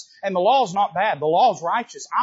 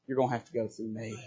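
softer, lower, firm protection; quiet protective warning; 5 semitones lower, 6 dB quieter than usual. Acted. David steps between them, quiet and firm. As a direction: shield him with your voice. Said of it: "And he says".

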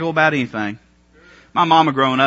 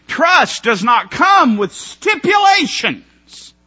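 slow, much higher shout; preaching shout; 9 semitones higher, 5 dB louder, much slower than usual. Real, not acted. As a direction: drive the point home slowly. Preacher climax.

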